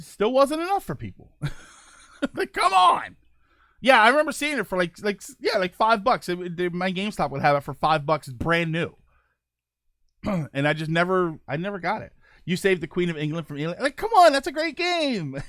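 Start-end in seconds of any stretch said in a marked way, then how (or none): 2.3-3.1 high, loud exasperated plea; incredulous, raised-voice appeal; exasperated outburst. Real, not acted. 13.8-15.4 high, loud protest, easing in volume; incredulous high-pitched appeal; exasperated outburst. Real, not acted. Pleading for an underrated game.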